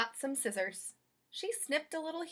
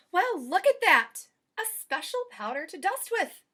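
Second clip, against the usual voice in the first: high, louder delighted cry; light up at the discovery; exclaiming; 6 semitones higher, 7 dB louder than usual. Acted. Maid discovering dusting powder, delighted exclaim. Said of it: "exclaimed Amelia Bedelia".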